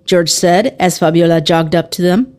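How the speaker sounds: plain reading voice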